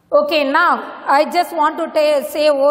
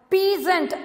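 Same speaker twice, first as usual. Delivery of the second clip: ordinary delivery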